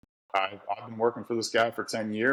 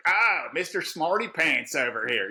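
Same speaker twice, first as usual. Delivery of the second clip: mocking coworker; 7 semitones higher than usual. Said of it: "then you get".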